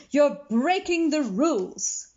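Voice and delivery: stern scolding. A narrator's reading. McBee scolds the lion for rule breaking, slow and stern. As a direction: tell him off for breaking rules.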